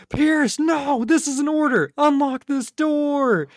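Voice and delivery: high, desperate mock command, slowing at the end; mock Chris ordering. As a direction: play Chris pleading with a squeaky voice. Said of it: "Where he's like".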